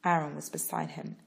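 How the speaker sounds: ordinary delivery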